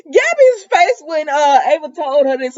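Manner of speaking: high, loud words through real laughter; laughing hard. Real, not acted. Vlogger laughing at Gabby's shocked face, cracking up. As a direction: crack up while trying to finish the line.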